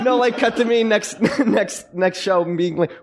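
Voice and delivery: high voice broken by real laughter; laughing. Real, not acted. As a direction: tell it while cracking up. Laughing as he tells his story.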